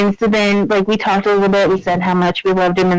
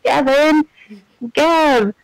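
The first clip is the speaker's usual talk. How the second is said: a higher mock call for a lost friend; mock shout; 6 semitones higher, slower than usual. Gamer imitating the game character calling for Gavin, mock shout. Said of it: "he's shouting".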